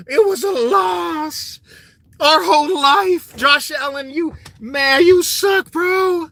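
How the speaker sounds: high, slow sobbing wail ending in a shout; mock crying fan. Mocking a Bills fan crying over Josh Allen, high wailing. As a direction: blubber and wail like a heartbroken fan. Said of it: "Crying over".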